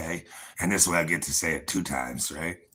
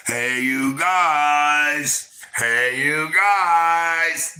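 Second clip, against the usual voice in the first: high, loud, long-drawn holler; Goonies movie yell; 9 semitones higher, 7 dB louder, much slower than usual. Retold or imitated. Fan quoting the old Goonies movie, drawn-out imitation yell. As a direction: stretch GUYS out into a big high-pitched shout. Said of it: "Let's go to the Goonies".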